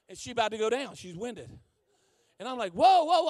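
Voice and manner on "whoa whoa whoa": urgent interjection. Retold or imitated. Stepping in to stop a quarrel.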